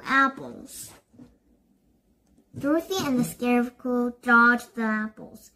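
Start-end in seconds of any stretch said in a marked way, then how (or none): none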